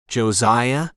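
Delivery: drowsy, just-woken calling out; sleepy call. A narrator's reading. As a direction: call out half asleep. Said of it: "Ray called in a sleepy voice".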